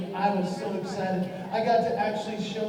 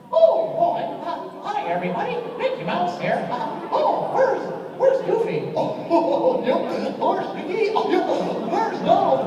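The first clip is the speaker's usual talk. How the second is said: high, bright squeaky cartoon mouse; Mickey Mouse voice; 10 semitones higher, 4 dB louder than usual. Third grader on stage doing Mickey Mouse, high squeaky. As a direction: chirp it like Mickey greeting fans.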